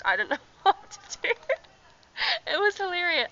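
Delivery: loud, real cracking up; words squeezed high through helpless laughter; laughing. Real, not acted. Cracking up, calls it hilarious.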